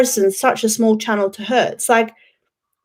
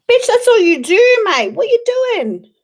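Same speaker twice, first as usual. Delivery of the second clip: high, loud, incredulous snap at her; fed up, scolding someone not there; exasperated outburst; 11 semitones higher, 6 dB louder than usual. Acted. Streamer snapping at a rival YouTuber, high and loud exasperation.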